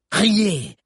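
high, loud biting command; grating order. Acted. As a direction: spit it out. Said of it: "grinça la sorcière". («Riez!» grinçant et fort)

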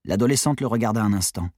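plain reading voice

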